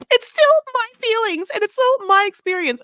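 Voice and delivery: very high, loud mock protest; mocking imitation. Mocking girlfriend defending her feelings, high loud protest. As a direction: push it far up, hammed-up and indignant.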